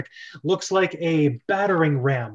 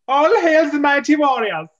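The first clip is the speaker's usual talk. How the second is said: much higher, louder hail; grand proclamation; 10 semitones higher, 8 dB louder than usual. Acted. Captain hailing the brothers as warriors. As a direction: hail them with full ceremony.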